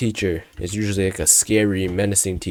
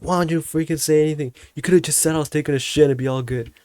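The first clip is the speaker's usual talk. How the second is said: higher-pitched mock outrage; scolding friends; 8 semitones higher than usual. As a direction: lay into them, pitched up. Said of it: "I'm crashing on my friends".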